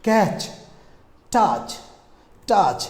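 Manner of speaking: usual speaking voice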